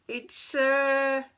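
high, loud, drawn-out "uh"; squeaky hesitation. Acted. Keith searching for an excuse, high squeaky hesitation. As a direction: stall in a squeaky cat voice.

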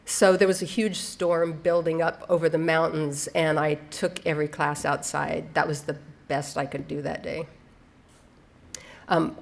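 usual speaking voice

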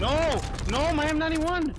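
high, loud cry of dismay; dismayed yell. Real, not acted. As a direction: wail over the lost gun. Loses his weapon in game.

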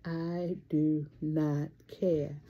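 slow deliberate catchphrase. Real, not acted. Vlogger telling viewers her motto, slow and firm. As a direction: lay it down slowly and firmly.

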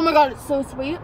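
talking while chewing candy; chewy food in mouth; mouth full, chewing. Real, not acted. Seen 0: Bites orange gummy, then chews with pursed lips while talking.

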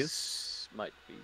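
soft whispered aside; whispering. Real, not acted. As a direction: breathe it out slowly.

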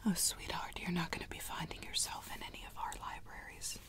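ordinary talk, nothing marked

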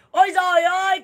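loud, high cry of dismay; shocked cry. Real, not acted. Livestreamer hearing of the Itaewon tragedy, loud shocked cry. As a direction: gasp it out in shock.

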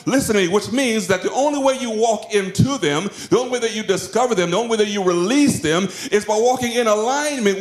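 high-pitched, driving sermon climax; relentless, fervent exhortation as God; impassioned preaching. Acted. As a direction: preach it urgently, pitch held high throughout. Preaches at full force in God's voice.

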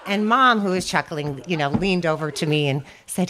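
usual speaking voice

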